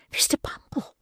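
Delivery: frightened breathless whisper; hushed, voice lost with fright. Acted. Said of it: "cried that discreet lady in a whisper".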